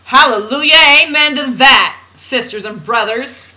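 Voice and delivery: loud, high hallelujah cry; mock preacher. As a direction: exclaim it like a revival preacher. Playful preacher exclamation to congregation.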